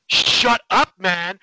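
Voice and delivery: loud, high "Shut up, man"; angry shout. Acted. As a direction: snap back at the heckler. Arguing in movie theater.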